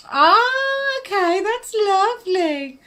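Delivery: high, slow, delighted ah; delighted surprise. Real, not acted. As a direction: let the news charm you, slowly. Touched by message's meaning.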